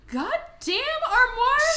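a hammed-up scandalized yell; mock outraged shout. Podcast host mock-yelling at the rude armoire character. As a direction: shoot up high and loud on Armoire.

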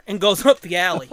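usual speaking voice

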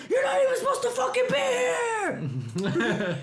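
high, loud retold shout at the driver; yelling. Retelling yelling at the driver who nearly hit her, loud shout. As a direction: scream it at the car that nearly hit you. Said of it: "I was, like, freaking out".

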